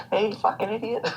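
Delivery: high-pitched parrot mimicry; squawking parrot. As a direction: squawk it like a rude pet bird. Voicing a loud parrot from a law.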